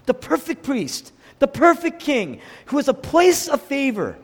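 high, building sermon proclamation; exalting preaching. Real, not acted. Preacher proclaiming the perfect king to the church, exalting preaching. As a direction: proclaim each title with rising force.

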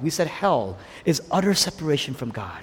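ordinary talk, nothing marked

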